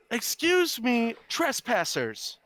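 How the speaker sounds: high, slow, officious woman; high-pitched woman's voice. Acted. Woman confronting trespassers, in a high-pitched voice. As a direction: draw it out high and indignant. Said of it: "in a high-pitched woman's voice".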